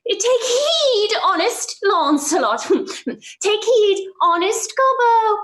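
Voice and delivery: conscience voice. Acted. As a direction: warn him like a fussy conscience. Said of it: "My conscience says".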